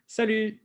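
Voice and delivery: Salut with lips pursed forward like a kiss; lips pushed forward. Shown as a demonstration. Teacher models Salut for students, lips pushed out. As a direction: round and push the lips out on Salut. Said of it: "bring your lips forward in that kind of kissy face".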